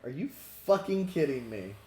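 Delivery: loud incredulous outburst; exasperated, can't believe it; disbelief. Real, not acted. GM reacts in disbelief.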